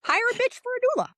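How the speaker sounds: squeaky high, fast sassy voice; high mock bitchy voice. Doula coaching clients to stand firm, squeaky high sass. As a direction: pitch it way up and play the sassy diva.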